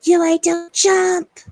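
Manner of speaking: loud, drawn-out high yell; sister's yelling. Retold or imitated. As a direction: yell a slow desperate warning across a distance. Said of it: "her sister comes yelling at her name".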